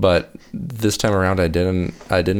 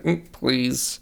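softer, slightly high mock-backhanded plea; 5 semitones higher, 5 dB quieter than usual. Fan showing how he read Madison's line, backhanded. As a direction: hum first, then a snide imitated please. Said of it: "backhandedly be like".

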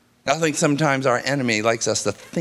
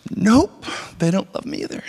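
lightly voiced small girl, higher and half-whispered; sulky child refusing comfort; a hint of a pouty daughter; pouting little girl; 4 semitones higher than usual.

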